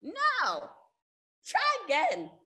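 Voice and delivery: mock-scold the student; high, loud, teasing refusal; sassy rejection. Acting out rejecting a student's answer.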